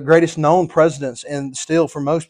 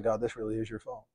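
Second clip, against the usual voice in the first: dropped almost to a murmur, much softer; hushed blame; 6 semitones lower, 14 dB quieter than usual. Retold or imitated. Preacher admitting to the congregation he'd blame God, very soft. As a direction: confide it quietly, half ashamed.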